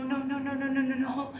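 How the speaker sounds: ordinary talk, nothing marked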